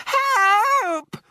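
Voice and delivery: a woman screaming as the mouse is swept about. A narrator's reading. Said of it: "screamed Missus Jenkins".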